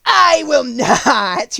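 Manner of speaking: loud, high, drawn-out witch refusal; defiant witch, stretching out the words; wicked witch retort. A narrator's reading. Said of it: "retorted the witch".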